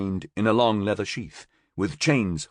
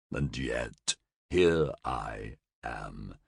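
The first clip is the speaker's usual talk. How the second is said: mocking creature; 7 semitones lower than usual. A narrator's reading. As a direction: purr the insults from a chained monster. Roxwolf voiced low.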